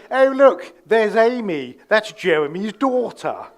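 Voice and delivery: high, loud affected posh English; posh English accent. Retold or imitated. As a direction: introduce someone in put-on posh English. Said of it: "They don't speak like that normally".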